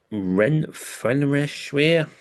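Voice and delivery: drawn-out Renfrewshire in a mock Scottish accent; put-on Scottish accent. Shown as a demonstration. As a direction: stretch out Renfrewshire with a Scottish lilt. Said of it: "I've put a bit of an accent into it".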